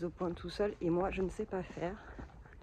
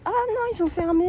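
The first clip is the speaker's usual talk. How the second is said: surprised; 11 semitones higher, 10 dB louder than usual. Real, not acted. Finds the park closed.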